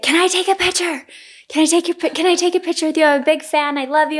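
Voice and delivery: high-pitched, excited fan imitation; gushing fan. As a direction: imitate an eager fan begging for a photo. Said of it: "and she's like".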